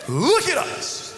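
slow, high call with a laugh; commanding demand. Acted. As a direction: repeat Peter's demand, slow.

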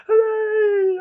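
very high loud cheer. Pretend. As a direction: whoop with joy. Cheering back.

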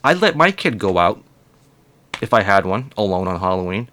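usual speaking voice

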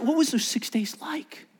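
half-whispered, high question; hushed wondering. Real, not acted. Preacher wondering about those six days, hushed. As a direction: drop to a near whisper as you wonder.

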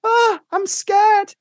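very high, drawn-out mock whimper; slow falsetto, hammed-up fear; scared wife. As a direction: squeal it as the frightened wife. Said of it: "And then I'm her on the phone".

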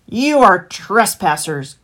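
slow, loud growl; heavy, drawn-out animal growl; growling fox. A narrator's reading. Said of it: "growled the fox".

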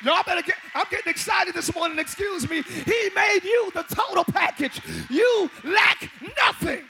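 loud, rousing preacher; preaching at full force. Acted. Preacher tells the congregation they are the total package, loud rousing preaching. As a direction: preach it to the rafters.

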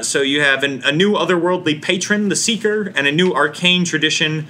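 normal talking voice

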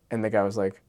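ordinary talk, nothing marked

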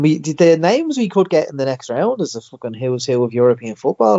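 usual speaking voice

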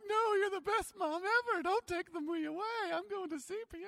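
put-on high desperate child pleading with mom. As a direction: beg mom not to be taken away. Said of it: "And I'm like".

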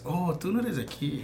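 high, soft imitation of someone surprised; mock surprise. Stranger noticing a foreign accent, mock surprise.

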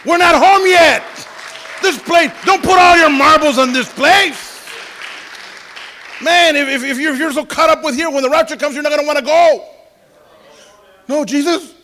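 full-force preacher warning; preaching shout. Real, not acted. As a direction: cry it out to the congregation. Preaching climax.

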